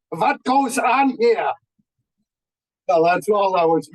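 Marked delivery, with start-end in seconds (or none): 0.1-1.5 put-on high, drawn-out German-accented demand; mock German accent, pitched up and stretched; terrible German accent. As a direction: call it out slowly in a thick German accent. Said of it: "had a terrible German accent".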